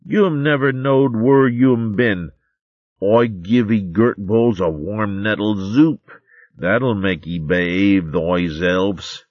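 little mole mimicking a fussy nun; imitation of Sister Cicely. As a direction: drawl it out as a prissy sister doling out soup. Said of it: "did his imitation of Sister Cicely".

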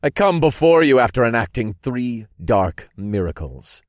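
dark lord's ringing address; commanding proclamation. A narrator's reading. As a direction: proclaim it to the gathered followers. Dark lord addresses his followers.

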